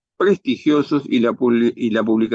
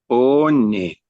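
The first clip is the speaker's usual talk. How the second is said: reading ONE slowly off a list; como leyendo. Acted. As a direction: sound out One as if searching for it. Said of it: "como leyendo". (leyendo One despacio)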